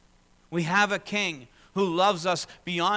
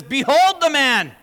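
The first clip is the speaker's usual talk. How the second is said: loud, slow proclamation; proclaiming; 11 semitones higher, 14 dB louder, slower than usual. Retold or imitated. Pilate shows Jesus to the crowd, loud and slow. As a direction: announce him to the crowd.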